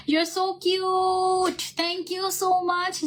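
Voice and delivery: high, drawn-out gushing; gushing thanks. Real, not acted. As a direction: coo it at an adoring fan, stretching the words. Gushing at a viewer's comment. (আদুরে টেনে টেনে উচ্ছ্বসিত ধন্যবাদ)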